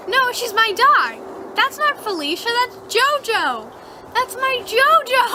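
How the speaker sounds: high, loud insistence that builds; acted indignant protest. Dreamer argues with woman over her dog, indignant and high.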